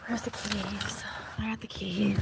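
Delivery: whispered search for keys; soft anxious whisper. Real, not acted. Whispering near sleeping kids.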